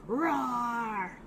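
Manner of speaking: mild demonstration roar; lion roar. Shown as a demonstration. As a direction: give a small, drawn-out lion roar. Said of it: "They sound like this".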